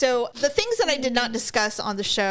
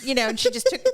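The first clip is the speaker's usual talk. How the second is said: normal talking voice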